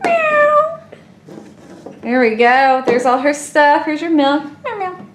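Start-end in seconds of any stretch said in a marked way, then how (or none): 0.0-0.8 high, cute meowing; playful kitten meows. Pretend. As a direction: meow like a tiny kitten. Voicing the toy kitten.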